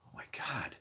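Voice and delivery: whisper. Retold or imitated. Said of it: "And I'm like".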